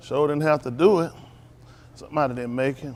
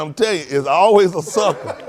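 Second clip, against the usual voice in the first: laughing quote; 4 semitones higher, 10 dB louder than usual. Retold or imitated. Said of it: "he said".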